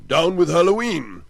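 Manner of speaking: high, loud ranting shout; angry man shouting down the holiday. Acted. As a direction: bellow it at the crowd. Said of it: "shouted Butterbean".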